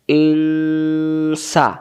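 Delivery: ইংসা with a very slow, hummed ng; hidden nun. Shown as a demonstration. Teacher reviewing the example, long nasal hum in ইংসা. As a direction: draw out the nasal start of ইংসা, then open to সা. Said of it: "এটা গোপন কেন হয়েছে".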